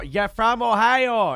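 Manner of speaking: loud, slow, over-clear for a deaf ear. Real, not acted. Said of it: "I'm talking to your left ear".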